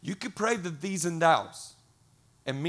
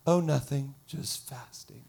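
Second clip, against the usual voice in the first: mock suffering. Faster answering a coworker for show, feigned suffering.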